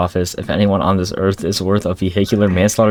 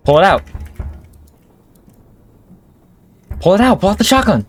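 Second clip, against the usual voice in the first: panicked shout; 12 semitones higher, faster than usual. Real, not acted. Panicked at a noise outside.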